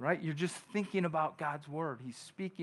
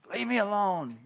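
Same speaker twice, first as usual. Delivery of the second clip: higher, lightly voiced irritated wife; mildly exasperated retort, pitched up; mock annoyed wife; 5 semitones higher than usual. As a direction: imitate a wife brushing him off. Sleepy wife woken at night.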